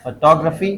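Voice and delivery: "Photography" as fuh-TOG-ruh-fee; stress demo. Shown as a demonstration. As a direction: lean on टो in "photography", reduce the rest. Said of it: "graphy से पहले क्या आ रहा है?". ("Photography" में टो पर ज़ोर)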